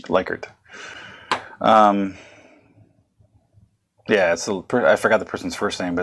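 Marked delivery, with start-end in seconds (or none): 0.0-0.5 Likert said LIKE-ert, long i; changed pronunciation. Shown as a demonstration. Lecturer showing the changed name, LIKE-ert pronunciation of Likert. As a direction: say Likert with "like" at the start. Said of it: "decided to change how it's pronounced".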